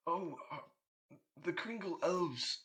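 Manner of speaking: lightly voiced anxious Santa; worried Santa. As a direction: fret over the elves as Santa.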